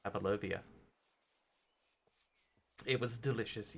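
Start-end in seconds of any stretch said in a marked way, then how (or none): 0.0-0.6 "Pavlovia" said softly with an extra -via ending; "Pavlovia", pav-lo-VEE-a, hushed; childhood mispronunciation. Shown as a demonstration. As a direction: quietly give the wrong "Pavlovia" version. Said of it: "pronounced Pavlovia".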